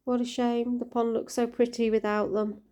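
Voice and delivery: ordinary delivery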